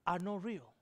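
usual speaking voice

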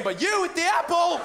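high-pitched mock accusation; single out the culprit in comic outrage; accusing customs officer. Customs officer turning on the apple carrier, mock-outraged.

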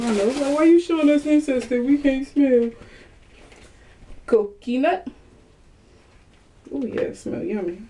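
ordinary delivery

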